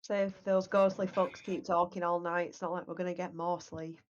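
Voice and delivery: ordinary delivery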